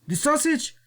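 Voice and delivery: high, silly voice for the wrestler; imitating La Parka. Mocking the wrestler on his cooking show, in a high silly voice. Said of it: "he's speaking his own language".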